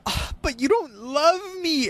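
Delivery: high, slow mock-whiny girlfriend; mocking a clingy TV girlfriend, slow and high. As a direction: drag it out like a sulking lover. Mocking a whiny love interest.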